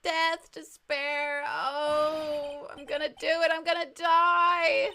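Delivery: slow, loud melodramatic wail; suicidal despair. Acted. Client who wants to die, wailing in despair. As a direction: drag it out, building to a wail. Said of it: "Sounds like he wants to die".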